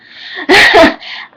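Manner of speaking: loud, high burst of laughter; real laugh. Real, not acted. Vlogger caught giving out names, laughing loudly. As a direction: laugh out loud at your own slip. Laughs at letting a name slip.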